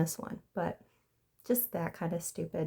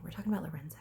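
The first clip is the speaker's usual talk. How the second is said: whisper; 7 dB quieter, much faster than usual. Real, not acted. Whispered aside.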